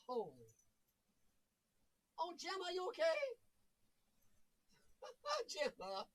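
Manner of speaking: calling the dog from across the room; far-off voice while checking on the dog; away from the mic. Real, not acted. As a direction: off mic, distant and faint.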